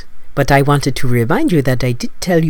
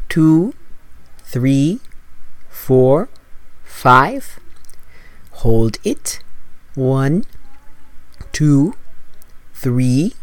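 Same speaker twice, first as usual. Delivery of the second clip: slow breath counting; 3 dB quieter, much slower than usual. Acted.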